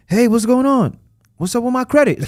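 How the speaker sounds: a client ringing up to demand news; impatient client. Retold or imitated.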